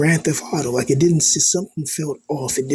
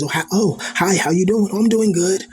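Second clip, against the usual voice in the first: higher, bright sing-song pleasantries, mock cheerful; fake-polite small talk; 7 semitones higher than usual. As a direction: ham up the forced politeness. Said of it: "they say the same things".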